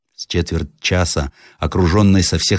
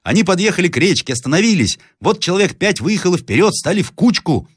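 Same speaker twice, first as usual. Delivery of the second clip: put-on very high, excited boy's report; крик мальчика; 12 semitones higher than usual. A narrator's reading. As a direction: call out what you see from the lookout. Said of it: "закричал мальчик". (высокий голос мальчишки)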